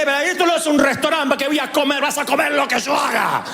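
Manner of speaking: high shouting mom, laying down the law; mother yelling this isn't a restaurant; scolding mother. Acted. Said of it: "pregunté". (madre que regaña a gritos)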